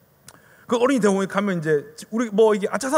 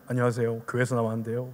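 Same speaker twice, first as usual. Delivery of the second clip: soft, low polite greeting; gentle; 8 semitones lower, 7 dB quieter than usual. Shown as a demonstration. Young trainee modelling polite evangelism, gentle. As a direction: approach a stranger mildly and courteously. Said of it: "좀 이렇게 젠틀하게".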